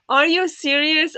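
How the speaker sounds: can't believe what you just read; shocked disbelief. Real, not acted. Shocked at a viewer's all-nighter.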